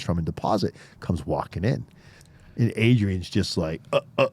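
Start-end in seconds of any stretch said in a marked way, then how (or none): none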